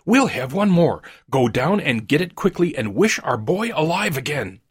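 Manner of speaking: high-pitched, exultant urging; triumphant. A narrator's reading. Wife sending her husband to wish again, triumphant. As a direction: command it in rising triumph. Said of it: "she cried triumphantly".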